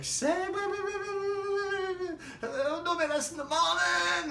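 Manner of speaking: Big Sargy calling after the fleeing couple, high and drawn out. Retold or imitated. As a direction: call them back in a strained high voice. Big Sargy calls after them.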